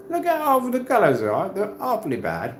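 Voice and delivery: high-pitched, put-on British accent; mock British accent. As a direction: play it as an affectedly British critic. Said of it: "when I talk like that, I'm not basically disrespecting the British".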